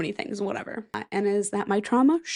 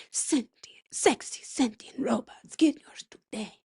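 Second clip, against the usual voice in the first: breathy whispered ad read for sexy sentient robots; slow seductive whisper selling robots; commercial voice; 2 semitones higher, slower than usual. Pretend. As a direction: sell the robots like a seductive late-night ad, slow and hushed. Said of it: "Using that voice".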